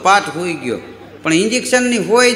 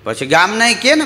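normal talking voice

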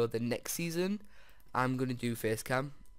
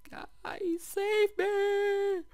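much higher squeaky cry for help; mock plea; 4 dB louder, much slower than usual. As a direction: squeak out a playful call to be rescued. Pleads viewers while hiding.

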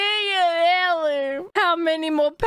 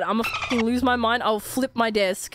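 ordinary delivery

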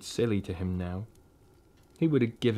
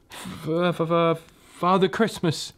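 frightened stammer, higher; 7 semitones higher than usual. Acted. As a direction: stutter out the name in fear. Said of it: "stammered the fox".

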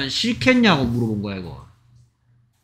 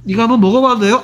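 higher-pitched, eager asking voice; acted request; 6 semitones higher, 4 dB louder, faster than usual. Customer asks to try some food, acted higher-pitched request. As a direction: ask as a customer wanting a taste.

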